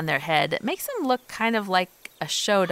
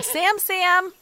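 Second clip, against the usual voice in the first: calling out; 12 semitones higher than usual. Real, not acted. Calling a lagging goat.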